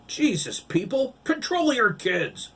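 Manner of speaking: high, loud exasperated shout; angry scolding. A narrator's reading. Man shouts at the parents to control their kids. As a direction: rebuke the parents.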